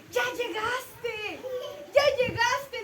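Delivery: slow, tearful and high; a small child crying with relief. Acted. Hungry little boy greeting the stranger, tearful.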